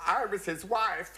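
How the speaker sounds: high, drawn-out mock wail; hammed-up weeping declaration; tearful. Crying lady revealing herself at the funeral, tearful. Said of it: "and she was crying".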